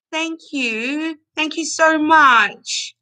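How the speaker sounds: slow, high, tearful thank you so much; real touched gratitude, drawn out; choked-up and slow; emotional thanks. Real, not acted. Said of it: "I'm a bit emotional right now".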